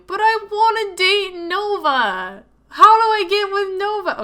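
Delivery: high, loud eager whine; excited whine. Real, not acted. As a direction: beg for the other love interest. Gamer pleading for other romance.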